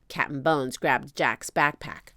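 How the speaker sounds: plain reading voice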